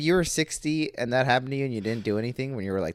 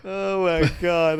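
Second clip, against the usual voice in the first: laughing; 7 semitones higher, 6 dB louder, slower than usual. Real, not acted. Reacting to the joke.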